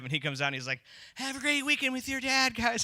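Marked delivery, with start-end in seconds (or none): 1.2-2.8 very high, put-on sing-song farewell; mock effeminate man. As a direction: send the kids off in an exaggerated unmanly high voice. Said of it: "At least talk like a man".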